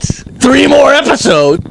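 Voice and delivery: startled, high incredulous question; real surprise. Real, not acted. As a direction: stretch it out in amazed disbelief. Surprised there are more episodes.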